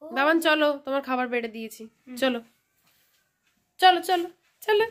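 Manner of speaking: high sing-song calling the little one. Real, not acted. Calling the baby to eat. (খেতে ডাকার আদুরে উঁচু সুর)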